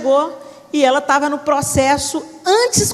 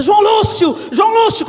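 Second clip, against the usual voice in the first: loud call through the door; 9 semitones higher, 5 dB louder than usual. Retold or imitated. Grandfather shouts for son-in-law at the bedroom door. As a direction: bang on the door and yell the name.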